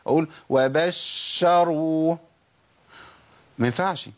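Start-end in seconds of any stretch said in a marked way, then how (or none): 0.5-2.2 وبشروا with the first sh held too long; over-long shin. Shown as a demonstration. Teacher showing what won't do, over-long shin in وبشروا. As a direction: drag out the doubled sh of وبشروا. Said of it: "ولا أزود زمن الشين الساكنة".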